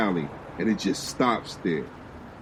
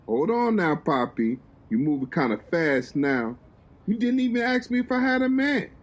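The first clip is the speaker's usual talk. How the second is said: teasing Jenny; 7 semitones higher than usual. Acted.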